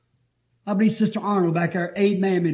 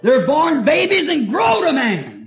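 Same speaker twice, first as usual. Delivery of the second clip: preaching shout; 7 semitones higher, 5 dB louder, slower than usual. Real, not acted. Preacher crying out his point.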